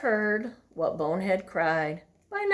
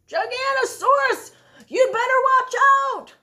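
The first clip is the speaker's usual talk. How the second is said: very high, loud warning yell; shout; 9 dB louder than usual. Acted. Bonehead shouting a warning to his friends. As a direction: bellow the warning. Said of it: "they heard a shout".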